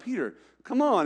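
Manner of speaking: high calling out to Peter from the boat. Retold or imitated. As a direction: call him in, a little fed up.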